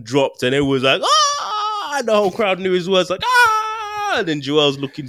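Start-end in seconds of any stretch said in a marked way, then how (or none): 1.0-2.0 high excited crowd cheer; crowd roar. Retold or imitated. Crowd roaring at the drop. 3.2-4.1 high excited crowd cheer; crowd roar. Retold or imitated. Crowd roaring at the drop.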